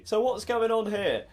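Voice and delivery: usual speaking voice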